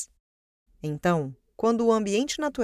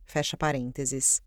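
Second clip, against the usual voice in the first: dictating; 4 semitones lower, 3 dB quieter than usual. Acted. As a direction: drop the voice to read out the brackets. Reads the brackets aloud.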